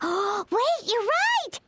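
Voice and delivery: gasp, then high excited wait, you're right; light bulb goes on; sudden realization. Acted. Paimon realizing who can help, high excited gasp.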